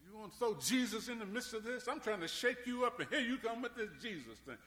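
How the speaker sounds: a high, mocking devil voice, lightly voiced; the devil mocking a believer who prays, high and scornful; Satan mocking. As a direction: taunt like a sneering Satan. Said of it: "it seemed like Satan said".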